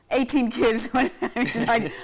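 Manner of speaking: breaking into laughter mid-story; laughing. Real, not acted. Reporter laughing while recalling chaos. Laughing retelling chaotic newsroom.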